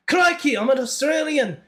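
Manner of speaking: high Aussie voice drifting Scottish; Australian accent. Shown as a demonstration. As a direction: attempt a broad Australian accent, high-pitched. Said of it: "it eventually turns into Scottish".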